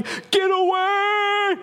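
high, drawn-out mock scream of terror; panic-stricken yell. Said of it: "inside my bedroom yelling".

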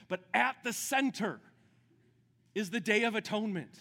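loud, high, slowed-down preaching shout; preaching at full force. Acted. Pastor to the congregation on the Day of Atonement, preaching at full force. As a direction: build the question up and cry it out to the room.

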